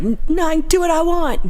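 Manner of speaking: high-pitched surly retort; mock-surly high voice; surly passenger. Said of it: "they were surly".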